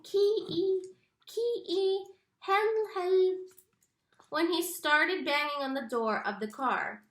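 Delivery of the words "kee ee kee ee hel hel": words muffled by something in the mouth; mouth full. A narrator's reading. Stuck brother calls Kenny with a blocked mouth, muffled. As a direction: call out through a stuck mouth. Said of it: "sounded like he had something in his mouth".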